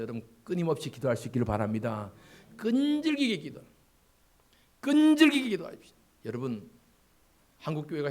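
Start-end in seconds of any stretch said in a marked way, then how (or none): 2.6-5.9 high, loud urging to pray; fervent preaching. Acted. Pastor urging the congregation to keep praying. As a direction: exhort the church at full force.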